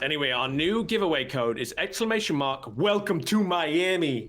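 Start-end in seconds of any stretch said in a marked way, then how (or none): 2.8-4.3 slow, slightly higher celebrity impression. Caster reading a giveaway code, playful impression. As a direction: draw it out like a catchphrase. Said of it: "that was my [name] accent".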